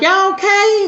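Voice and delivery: put-on high, loud alarmed call to the kids; worried mama checking on her crying kids; alarmed mother. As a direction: call out in sudden worry. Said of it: "you know, I'm like".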